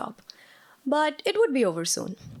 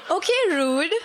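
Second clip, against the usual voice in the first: mock offended; 8 semitones higher than usual. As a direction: play it hurt but amused. Offended reply to accent jab.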